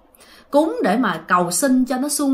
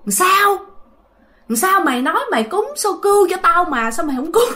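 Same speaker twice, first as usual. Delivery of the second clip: ghostly accuser; 7 semitones higher than usual. Retold or imitated. Ghost women accuse grandpa.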